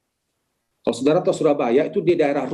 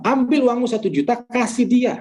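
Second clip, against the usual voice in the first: higher-pitched, insistent divine command; God's voice; 8 semitones higher than usual. Retold or imitated. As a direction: press the order home on a raised pitch. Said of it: "Tapi Tuhan bilang". (suara Tuhan memerintah dengan nada tinggi)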